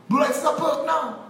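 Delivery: mock shout. Mocked crowd, rising to a shout.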